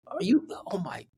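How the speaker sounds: breathless whispered gasp of awe; awed whisper. Retold or imitated. Starstruck fan sees his hero across the room, whispering. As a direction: whisper it like you can't believe who's there. Awestruck at seeing Max.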